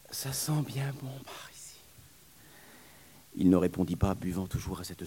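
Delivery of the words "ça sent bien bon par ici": whispered with relief; soft whisper. Acted. Relieved nurse whispering in the train, whispered. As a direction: breathe it out contentedly.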